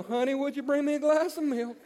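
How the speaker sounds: high, intoned preacher parody; mock pastor voice on a homely request. Preacher at home asks wife for milk, mock pastor voice. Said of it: "does not talk that way".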